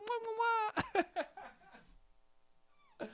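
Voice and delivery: high smacking mwah kisses; kissy noises. Retold or imitated. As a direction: blow silly cartoon kisses. Kisses in cutesy voice.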